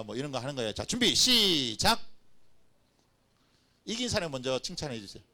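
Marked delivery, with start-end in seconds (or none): 0.7-2.0 slow, loud, higher go signal; loud start call. Real, not acted. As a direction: call the start for the whole room. Starting the game for the crowd.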